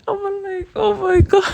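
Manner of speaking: high, giggly swoon; real giddy laughter in the voice; giddy giggling. Real, not acted. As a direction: squeal it through giggles. Giddy recalling the dream kiss.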